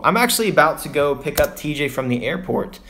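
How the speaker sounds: normal talking voice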